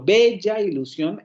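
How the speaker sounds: "bella ilusión" with BE high and stretched; slow, leaning hard on BE in "bella"; stress on first syllable. Shown as a demonstration. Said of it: "cae el acento en la primera sílaba".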